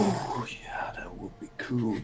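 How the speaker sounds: soft breathy whisper. Pretend. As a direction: whisper it like a daydream. Whispered dreamy agreement.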